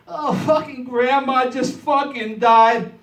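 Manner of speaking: mock wailing mourner. Comic imitating mourners crying over grandma, loud, slow mock wail. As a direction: ham up the grief like a crying mourner.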